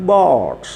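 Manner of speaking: ബോട്ട് slowly, with a stretched O; long vowel. Shown as a demonstration. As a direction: draw out the single O in ബോട്ട്. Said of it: "അവിടെ long level സൗണ്ടാണ്". (ബോട്ട് ദീർഘിപ്പിച്ച് ഉച്ചരിക്കുക)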